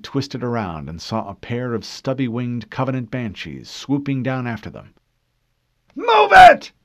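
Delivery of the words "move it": very high, very loud scream. A narrator's reading. Harlan screaming at Fincher to drive. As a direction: scream it over the battle. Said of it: "he screamed at Fincher".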